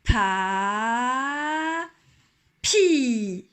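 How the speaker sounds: blending syllables into papy; syllables linked. Shown as a demonstration. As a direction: link the syllables Pa and pi. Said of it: "On y va, on va accrocher".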